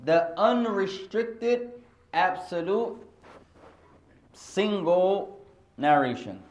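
slow, deliberate term. Shown as a demonstration. Teacher dictating a term to the class, slowly. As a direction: spell the term out slowly for students. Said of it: "teaching the term".

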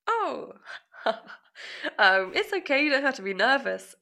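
teacher soothing a student who started crying; gentle teacher. Retold or imitated. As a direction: reassure a crying student kindly. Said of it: "And he was like".

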